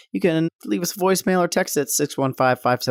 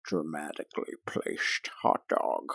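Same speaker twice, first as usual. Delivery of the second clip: hushed, breathless Connery impression; whispered Sean Connery; 10 semitones lower, 11 dB quieter than usual. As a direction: whisper it like a secret agent. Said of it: "I wanna be Sean Connery".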